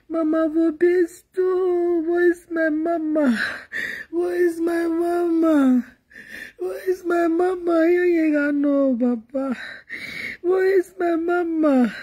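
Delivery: high, slow, drawn-out cry; keen like a bereaved child calling for mother; acted sing-song lament; wailing for mama.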